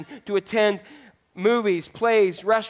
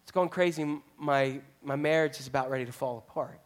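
softer, lower hushed plea; troubled coworker; 6 semitones lower, 6 dB quieter than usual. Retold or imitated. Coworker confiding a failing marriage, quiet and low. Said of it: "and he says to you".

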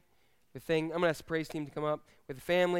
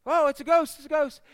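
high and loud panicked cry; mock terrified scream; 10 semitones higher, 8 dB louder than usual. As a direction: shriek it like you saw a ghost. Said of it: "they screamed and they said".

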